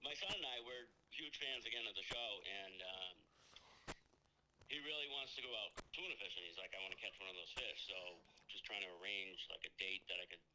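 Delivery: over the phone. Real, not acted. Caller heard over phone speaker.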